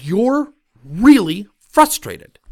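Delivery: slow, loud punch on "really" and "frustrated"; emphatic naming. Retold or imitated. Coach emphatically naming manager's frustration.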